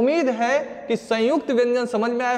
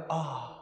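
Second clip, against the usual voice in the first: soft mock gasp of pain; teacher acts being pecked in the throat, soft pained "aah"; pained groan; 8 semitones lower, 12 dB quieter than usual. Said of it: "अब मैंने बोला".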